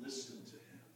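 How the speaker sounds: low, soft voiced repeat; soft command. Preacher repeats heaven's command to the disciples, softly spoken. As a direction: say it quietly and low, with voice.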